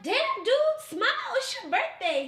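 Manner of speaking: high, loud, swooping retold plea; exasperated urging. Telling the grimacing birthday date to smile, exasperated urging. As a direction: coax him with hammed-up exasperation.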